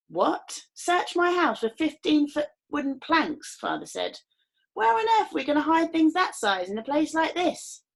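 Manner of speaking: mock astonishment. A narrator's reading. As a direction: play shocked innocence.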